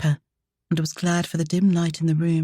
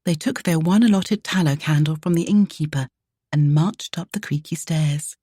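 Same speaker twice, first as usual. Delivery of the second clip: ordinary delivery, nothing marked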